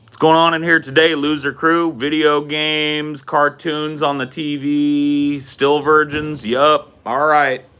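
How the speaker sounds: hammed-up sneering dad roll call; mean teasing dad. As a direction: drag out each jab to needle the kids. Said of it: "He'd be like".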